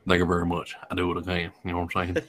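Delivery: low rock-star mumble. Acted. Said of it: "I landed on [name]".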